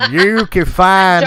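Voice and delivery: slow, loud mock announcer. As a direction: stretch it out like a big announcer.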